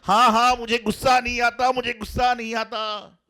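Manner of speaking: high, loud furious insistence; angry shouting denial. Retold or imitated. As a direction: shout that you're not angry. Man denies anger while angrily shouting.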